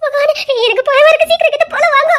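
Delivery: high, loud panicked plea to God; terrified cry. Acted. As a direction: cry out to God in sheer fright. Frightened woman pleads with God. (பயத்தில் கதறி கடவுளை அழைப்பது)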